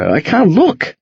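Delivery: ordinary delivery, nothing marked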